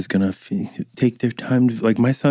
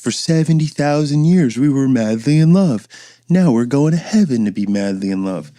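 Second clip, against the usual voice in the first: mocking lovey-dovey couple; 5 semitones higher, 3 dB louder than usual. Mocking a perfect loving couple.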